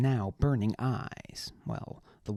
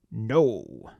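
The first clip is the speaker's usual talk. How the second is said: booming basso; 7 dB louder than usual. Acted. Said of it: "Rox's basso was like the crashing of a boulder".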